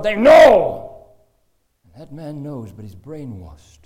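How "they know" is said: a huge, high shout of conviction; forceful shout. Real, not acted. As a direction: hammer home the conviction. Preacher insisting on his point.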